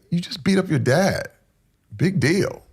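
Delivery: high-pitched sarcastic congratulation; self-mockery. Man mocking himself for beating his dad, sarcastic. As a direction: belittle the win, pitched well up.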